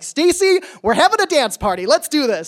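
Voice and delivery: high, eager pep-up call; upbeat mock party announcement; acted rallying cry. Acted rallying of upset friend.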